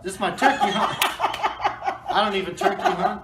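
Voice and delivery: loud, high, helpless laughter through the words; laughing while talking. Real, not acted. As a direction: crack up as you say it. Cracking up over own outfit.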